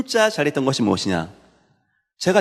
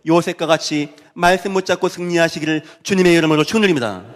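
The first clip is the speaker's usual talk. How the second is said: raised, rising benediction in preaching voice; preacher's blessing; 5 semitones higher, 3 dB louder than usual. Real, not acted. Preacher blesses the congregation in the Lord's name, fervent preaching. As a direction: pronounce the blessing with fervor.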